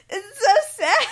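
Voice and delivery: high, loud mock wail through laughter; hammed-up sobbing complaint; mock bawling. Babysitter caught crying over a book by returning parents, mock bawling.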